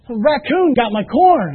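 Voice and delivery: mock-dramatic complaint about the corn; retold outrage. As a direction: relive the shock of the loss. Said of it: "I was so mad".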